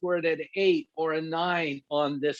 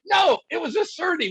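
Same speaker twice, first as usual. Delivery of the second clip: indignant shout; 11 semitones higher than usual. Retold or imitated. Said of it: "I go".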